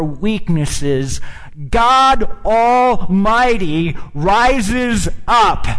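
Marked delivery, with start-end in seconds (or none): 1.7-5.7 slow, loud, high proclamation; preaching shout. Real, not acted. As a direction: thunder it out slowly from the pulpit. Preacher builds to climax.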